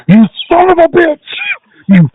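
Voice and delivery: high-pitched indignant mock cursing; mock outrage. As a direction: curse them out in hammed-up outrage. Mimics outraged reaction to American announcers.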